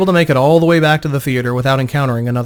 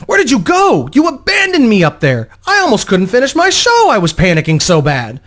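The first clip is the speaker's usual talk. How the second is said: much higher, loud, breathless reproach; frantic panic; 9 semitones higher, 5 dB louder than usual. Acted. Panicked performer berating Vo for leaving, frantic and shrill-high. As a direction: blurt it all out in panic.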